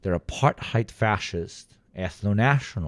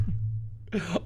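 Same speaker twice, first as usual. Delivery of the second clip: exasperated breathy laugh; short unvoiced laugh of disbelief; annoyed laugh; 8 semitones higher, 9 dB quieter than usual. Real, not acted. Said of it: "I just did a ha ha".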